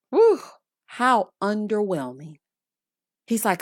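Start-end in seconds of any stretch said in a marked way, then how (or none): 0.1-0.4 a sharp high exhale; high whew. Real, not acted. Host reacting to the apology, high loud whew. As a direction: let out a loud unimpressed whew.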